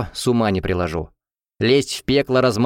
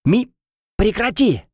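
high urgent call; urgent cry; 10 semitones higher than usual. A narrator's reading. Derry turns to heroine mid-fight, crying out. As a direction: cry out to make someone stop. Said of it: "закричал Дерри".

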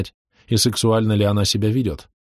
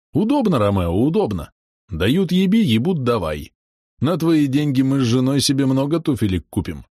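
jolly pilot voice; 5 semitones higher than usual. A narrator's reading. Shurik voiced in story.

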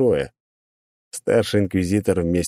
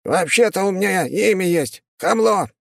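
high huffy imp snapping; offended demon; 13 semitones higher than usual. Acted. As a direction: bristle like an insulted little demon.

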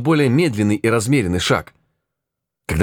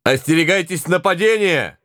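shouted warning; 9 semitones higher than usual. Acted. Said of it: "голос Монтгомери".

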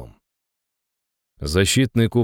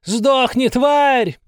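high, loud yell of rage; furious shout; 5 dB louder, much slower than usual. A narrator's reading. Baroness facing down the approaching monster, furious shout. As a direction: hurl it out furiously. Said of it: "женщина яростно выкрикнула".

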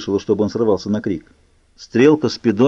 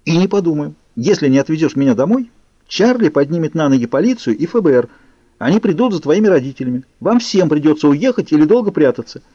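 defiant threat; 6 semitones higher than usual. A narrator's reading. Bella threatens Edward in the car.

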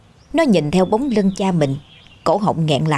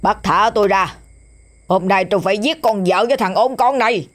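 a hint of a raging drunk struggling; mock furious yell; 3 semitones higher than usual. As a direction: rage while held back, lightly voiced. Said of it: "dùng dằn gào lên".

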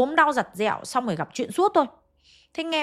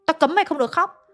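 higher, louder scolding bark; retold angry shout; 5 semitones higher, 3 dB louder than usual. Uncle shouting at the crying mother, a higher, louder scolding. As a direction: snap at her to stop crying. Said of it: "Ông bác thấy thế mới quát".

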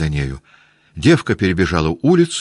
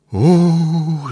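high loud howl; howling wail; 10 semitones higher, 5 dB louder than usual. A narrator's reading. Maid wails pointing at the burning house, loud howl. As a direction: wail out in dismay. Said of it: "завыла девка".

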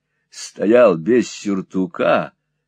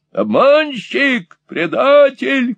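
high, slow furious shriek; high shrieking rage; shouting lady; 13 semitones higher, 4 dB louder, much slower than usual. Acted. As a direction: scream the accusations at him, high. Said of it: "кричала леди".

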